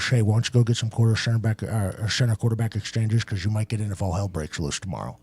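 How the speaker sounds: low, very soft mumbled coach voice; imitating the coach. Coach quietly asking the backup to practice snaps, low mumble.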